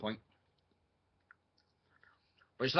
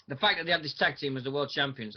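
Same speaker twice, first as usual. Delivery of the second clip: ordinary delivery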